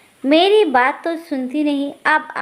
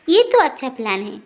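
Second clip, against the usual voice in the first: usual speaking voice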